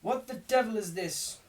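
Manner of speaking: man storming out of a beer shop; man bursting out. A narrator's reading. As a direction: burst out at the commotion. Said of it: "said the man, bursting out".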